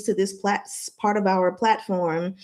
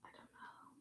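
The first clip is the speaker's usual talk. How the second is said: whisper; 38 dB quieter than usual. Acted. Whispered aside.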